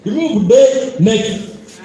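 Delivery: "Remove the next" said very slowly, each word clear; slow demo phrase. Shown as a demonstration. Coach modelling the corrected line, slow and careful. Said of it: "Don't say".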